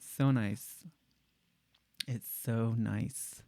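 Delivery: "so nice" softly, with a sharp sibilant S; soft, lingering S on "so" and "nice"; tip-of-the-tongue S. Shown as a demonstration. Demonstrating the right impression, soft "so nice" with tongue-tip S. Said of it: "just at the tip of the tongue".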